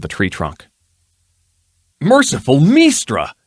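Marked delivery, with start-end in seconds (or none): 2.0-3.3 higher, louder shocked exclamation; exclaimed. Acted. Nobleman exclaims at the dead ruffian, shocked. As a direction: gasp it out in shock. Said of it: "exclaimed Danilo Than".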